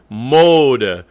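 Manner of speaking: "Mode" with loud long MO, weak schwa; accented first syllable. Shown as a demonstration. Coach showing the schwa, "Mode" stressed on its first syllable. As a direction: punch "Mo" and let "de" drop away. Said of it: "Mode is the accent, so de is also a schwa".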